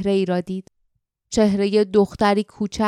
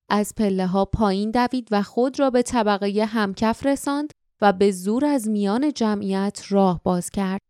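ordinary delivery, nothing marked

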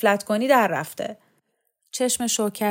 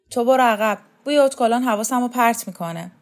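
loud, raised command; bossy order; 4 semitones higher, 6 dB louder than usual. Acted. Shokat orders perfumed Kaveh to step back, loudly. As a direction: send him off with loud annoyance.

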